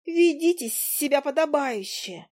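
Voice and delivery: high, slow, tiny scolding voice; a prim little fly lecturing the young man. Acted. The fly Zhuzha scolding Willy, high and slow. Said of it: "подключила Жужа".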